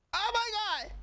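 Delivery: high, shocked squeal of disbelief. Real, not acted. Shocked reaction to the story.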